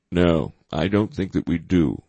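ordinary delivery, nothing marked

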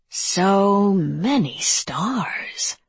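slow, voiceless monster whisper. Acted. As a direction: savor each word like a monster. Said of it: "said the monster".

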